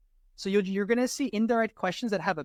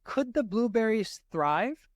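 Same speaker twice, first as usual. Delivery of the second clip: slow question rising at the end; direct question; 4 semitones higher, slower than usual. Shown as a demonstration. Tutor shows the direct question form, slow and rising. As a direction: ask it with a rising question tune. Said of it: "is more of a question, a direct question".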